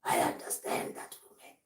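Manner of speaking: breathy whispered understanding; hushed, voiceless act; voice going down. Acted. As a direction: let the voice drop into a whisper. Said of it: "your voice goes down".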